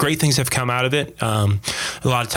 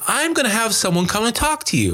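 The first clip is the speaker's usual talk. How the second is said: higher, careful nurse voice; evasive nurse; 11 semitones higher than usual. Retold or imitated. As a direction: dodge the question politely. Nurse evading question.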